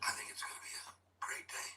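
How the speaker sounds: hushed golf announcer whisper; golf commentator. Shown as a demonstration. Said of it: "talking with my golf voice".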